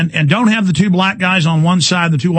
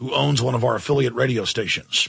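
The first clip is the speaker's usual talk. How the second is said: softer, lower sponsor read; 7 semitones lower, 7 dB quieter than usual. Acted. Radio host reads a sponsor ad to listeners, softer and lower. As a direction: read the sponsor spot evenly.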